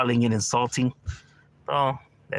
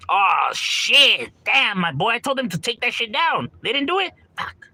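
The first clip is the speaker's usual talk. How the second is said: loud, high embarrassed laughing groan; caught off guard; 7 semitones higher, 5 dB louder, slower than usual. Real, not acted. Streamer reacting to a viewer spotting him in a video, loud flustered surprise. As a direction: react like you got exposed.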